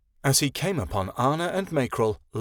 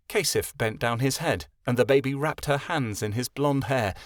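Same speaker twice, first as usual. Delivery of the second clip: ordinary delivery, nothing marked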